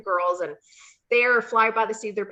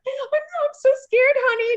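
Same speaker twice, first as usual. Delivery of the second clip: high, loud, slow hammed-up fright; mock scared; 7 dB louder than usual. To her fake husband, high hammed-up fear. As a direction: play the frightened wife to the hilt. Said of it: "I'm like".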